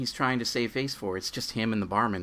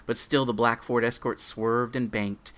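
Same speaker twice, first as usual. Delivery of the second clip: ordinary talk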